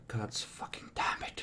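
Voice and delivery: whispered curse under the breath; muttered curse. Acted. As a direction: mutter it very low, barely voiced. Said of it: "You just hear a mutter".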